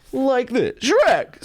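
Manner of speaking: slow lead-in snapping to a high yelped Shrek; mock impression demo. Shown as a demonstration. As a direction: drag it out, then shriek the name way up. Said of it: "and then I go".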